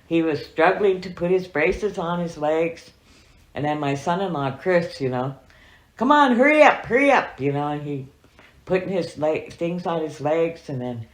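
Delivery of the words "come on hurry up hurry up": high, loud hurry-up call; urgent call. Retold or imitated. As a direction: urge him out the door, loudly. Urging grandpa to hurry.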